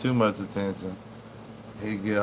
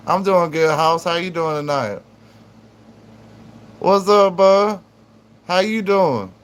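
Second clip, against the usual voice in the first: louder, higher hello to the chat; excited greeting; 6 semitones higher, 6 dB louder than usual. Real, not acted. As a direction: call out the viewers' names with a burst of energy. Greeting chat viewers by name.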